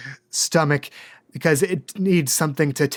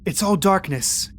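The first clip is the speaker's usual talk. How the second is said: game master narration. Acted. GM describes dream scene.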